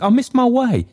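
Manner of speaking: put-on high, fast, frantic old man. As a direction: blurt it out in panic. Trotty's frantic explanation.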